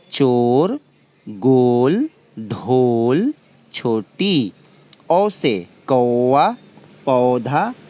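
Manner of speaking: each word spelled out slowly and clearly for pupils; slow, clear classroom word drill; careful demo pronunciation. Shown as a demonstration. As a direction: say each word distinctly like a teacher modelling sounds. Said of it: "मैंने स्वर वर्ण से बने हुए शब्दों का उच्चारण किया".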